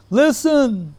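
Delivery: acted call to attention, pitched right up; Babaji Maharaj. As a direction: mimic him with one high, drawn-out command. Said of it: "That's all he said".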